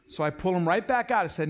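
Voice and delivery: ordinary talk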